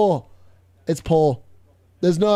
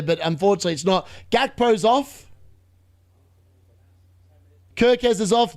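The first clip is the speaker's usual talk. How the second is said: usual speaking voice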